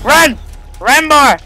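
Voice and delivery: high, urgent cries to run; urgent yelling. Real, not acted. Gamer fleeing an enemy, urgent yelling. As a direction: yell it as you flee.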